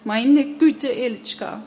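loud, high anguished cry; high wail, easing on the name; woman's scream. A narrator's reading. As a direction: cry out in shock. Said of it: "schaute sie auf Sandro und schrie".

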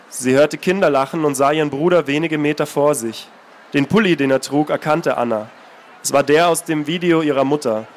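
calm, measured reading of a novel; story narration. A narrator's reading. Author reading his novel aloud, steady narration. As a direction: read the passage steadily, letting it speak.

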